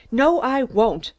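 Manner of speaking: loud, slow, defiant refusal; yelled. A narrator's reading. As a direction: bellow it and dig in. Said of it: "I yelled".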